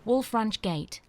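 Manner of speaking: ordinary talk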